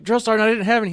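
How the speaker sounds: high, loud sound-off answer; recruit sound-off. Retold or imitated. Recruit answering the drill sergeant, loud sound-off.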